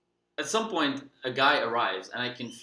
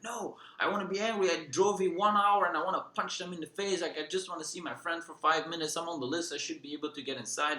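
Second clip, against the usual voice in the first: higher, put-on sulky protest; mock angry whine; 5 semitones higher than usual. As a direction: imitate a grumpy man refusing to calm down. Angry Lucas refuses to calm.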